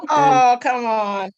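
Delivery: loud, drawn-out mock objection; playful protest. Real, not acted. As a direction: protest the teasing, stretching it out loudly.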